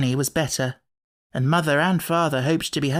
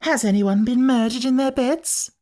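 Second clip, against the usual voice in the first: put-on much higher, breathless eager girl; thrilled horror; 9 semitones higher, 3 dB louder than usual. A narrator's reading. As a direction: ask with a delicious thrill of fear. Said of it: "agreeable thrill of horror".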